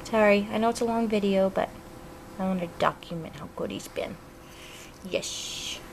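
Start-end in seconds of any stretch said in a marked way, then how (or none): none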